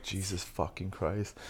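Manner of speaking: slow, very quiet dismay; muttered exasperation. Retold or imitated. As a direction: swear slowly under your breath. Reacting to her loud cackle.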